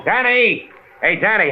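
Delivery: calling out. Acted. Calling out from car.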